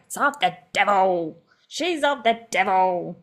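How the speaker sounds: hammed-up movie-mother denunciation; mum from Waterboy. Imitating a film mum condemning someone as devilish, hammed-up accusation. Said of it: "I sound like the mum from Waterboy".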